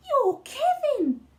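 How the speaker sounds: high loud squeal. Acted. Sid meeting Kevin at last, high excited squeal. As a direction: squeal with delight at meeting him. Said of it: "said Sid with a squeal".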